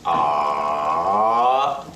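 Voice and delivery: long "ah" sinking low then rising; slow dipping "ah"; third tone. Shown as a demonstration. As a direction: draw "ah" down and back up. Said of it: "pitch is following your body movement".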